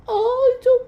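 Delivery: high cooing mock comfort; mocking how they soothe her. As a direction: coo it sweetly like comforting a crying woman. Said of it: "once that woman was crying".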